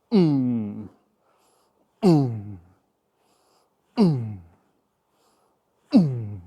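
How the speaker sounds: short "mm" effort grunt on each swing. Shown as a demonstration. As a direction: grunt on each rep of the demo. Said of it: "then it looks like this".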